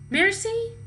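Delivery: high, loud sing-song call. A narrator's reading. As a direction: call the pig in from afar. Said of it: "Missus Watson called".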